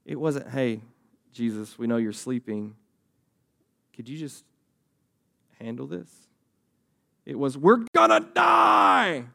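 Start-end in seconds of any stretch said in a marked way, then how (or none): 0.5-6.3 low-key, hushed and hesitant asking; softly, with pauses, as if not to disturb a sleeper; calm, quiet request. Acted. Pastor imagines disciples politely waking Jesus, calm and quiet. 7.6-9.3 high, loud, drawn-out wail of panic; panicked shout. Acted. Pastor acts out disciples freaking out in the storm, panicked shout.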